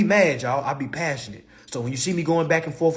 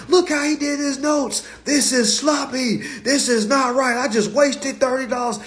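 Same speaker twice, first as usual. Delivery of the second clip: mocking critic; 10 semitones higher than usual.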